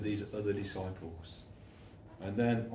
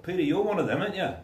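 high, loud pointed challenge; a bystander pressing Peter again; second accuser; 8 semitones higher, 7 dB louder, faster than usual. Acted. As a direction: push him to admit it.

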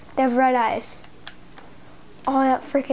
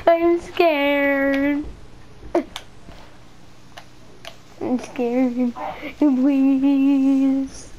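mock pleading; 2 semitones higher, much slower than usual. Gamer pretending to be frightened, mock begging. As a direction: beg like a scared little kid.

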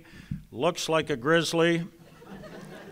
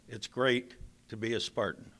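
softer and lower, quietly sincere; heartfelt; 7 semitones lower, 9 dB quieter than usual. Real, not acted. Coach to his old school, softly sincere. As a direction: let it drop to a hushed, sincere close.

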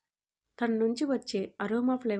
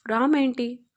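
impatient, pushed louder; annoyed and louder; irritated; 3 semitones higher, 7 dB louder, slower than usual. A narrator's reading. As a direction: snap the question, fed up. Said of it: "అని అడిగాడు విసుక్కుంటూ".

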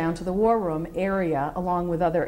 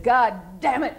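higher, louder furious outburst, a bit held back; retold angry yell; 7 semitones higher, 3 dB louder than usual. General slamming the table at his staff, angry yell. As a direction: bellow it at the whole table in exasperation. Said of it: "And he's screaming".

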